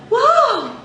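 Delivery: mock wrong head-voice flip; flipped head-voice whoa. Shown as a demonstration. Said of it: "I wouldn't go".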